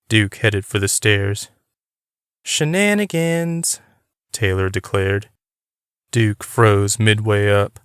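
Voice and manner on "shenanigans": drawn-out high declaration; declaring. Acted. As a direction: announce it slowly like a gotcha. Taylor calls out the rule word.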